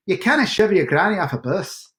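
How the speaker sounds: mock Scottish accent. Shown as a demonstration. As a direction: recite it as an amateur Scot. Attempting requested Scottish phrase.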